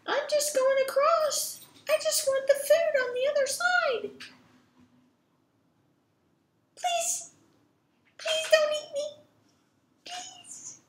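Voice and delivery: very high, tiny pleading voice; squeaky-high begging; frightened little billy goat. Acted. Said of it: "And the little billy goat says".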